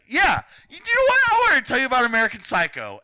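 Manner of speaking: very high, loud, fast rant; exasperated outburst. Real, not acted. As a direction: blow up at your friend, squeaking. Exploding in a mock argument.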